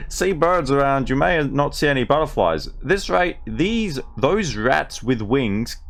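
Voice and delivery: broad ocker Australian voice, played for laughs; reading a butterfly book as a brash Australian; mock Australian accent. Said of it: "we're going with the Darrow Australian".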